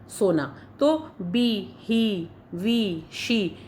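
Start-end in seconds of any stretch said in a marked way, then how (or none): 1.8-3.0 ही, वी with a drawn-out long ई; stretched ee. Shown as a demonstration. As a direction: stretch the ई in ही and वी slowly. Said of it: "बड़ी ई है लेकिन हम उसको थोड़ा खींच रहे हैं". (ई को खींचकर धीरे बोलें)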